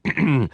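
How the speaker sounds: an annoyed king clears his throat; king covers his sputtering with a throat clear; royal throat clearing. Acted. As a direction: cough to cover the stumble. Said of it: "sputtering a little and seemed annoyed".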